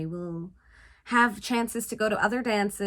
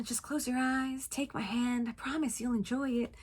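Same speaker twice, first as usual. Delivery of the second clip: hushed, gentle persuasion; soft coaxing; 2 semitones higher, 9 dB quieter than usual. Retold or imitated. Michael asking Cindy to trust him, softly coaxing. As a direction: drop the volume and coax.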